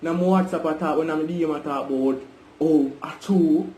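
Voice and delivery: normal talking voice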